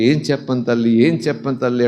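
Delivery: normal talking voice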